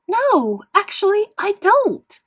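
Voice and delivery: high, loud angry refusal; angry yelling. A narrator's reading. Daughter screaming back at her mother, angry.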